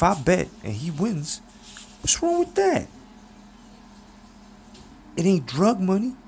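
Clever's voice. Acted. Clever hails his old friend Pops in a high, slick voice. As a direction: greet him like a smooth, flashy hustler.

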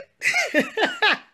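loud high laugh; high burst of laughter. Real, not acted. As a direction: let out a big laugh.

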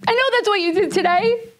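high, accusing confrontation; retold yelling. Wife confronting husband over his using, retold mild yell. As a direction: raise the pitch and accuse, short of a full yell. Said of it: "And I'm yelling".

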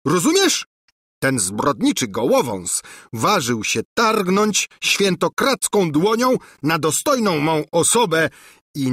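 a king barking out a death order; raging king. A narrator's reading. Enraged king gives death order. (rozwścieczony król wydaje rozkaz)

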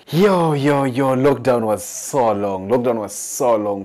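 loud, slow hype call. Real, not acted. To the crowd, a loud hype opener. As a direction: shout it out to the room. Calling out to audience.

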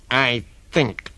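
crocodile talking through clenched teeth; between the teeth. Acted. Crocodile holds the child's nose, talking between his teeth. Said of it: "said it between his teeth like this".

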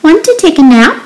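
high storybook cat. A narrator's reading. Cat inviting the spider to nap, high voice. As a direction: ask it high, like a little cat. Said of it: "cried the cat".

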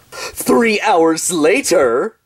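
drawn-out announcer reading a time skip; slow title card. Acted. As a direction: stretch each word like a caption.

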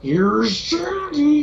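slow, high, stretched-out quote; teasing drawn-out film impression; mock movie catchphrase. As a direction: draw out each word of the entrance line. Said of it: "I was gonna be like".

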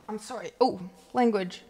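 ordinary talk, nothing marked

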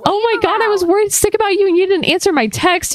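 very high-pitched mock scolding, easing slightly at the end; acted chewing out. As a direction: chew him out in a high, hammed-up voice. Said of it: "she's chewing him out".

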